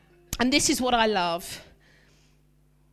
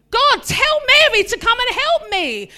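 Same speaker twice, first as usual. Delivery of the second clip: higher, louder exasperated whine; exasperated plea; 10 semitones higher, 9 dB louder than usual. Retold or imitated. Martha in the kitchen complaining to Jesus, exasperated. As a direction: complain like a busy, put-upon host. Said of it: "like Martha in the kitchen".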